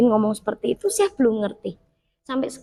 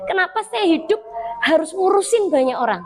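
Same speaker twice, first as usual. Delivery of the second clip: weary complaint; 7 semitones higher than usual. Retold or imitated. Complaining to God, worn out.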